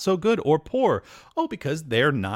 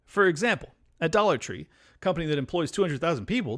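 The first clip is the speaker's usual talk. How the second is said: usual speaking voice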